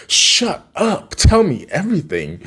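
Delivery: high, hammed-up disbelief; mock excited gossip. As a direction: act thrilled by juicy news. Acting eager reaction to girl news.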